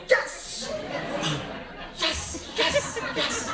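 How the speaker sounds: loud, high exultant yeses; inner jubilation. Retold or imitated. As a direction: celebrate a secret victory out loud. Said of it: "inside I'm going like".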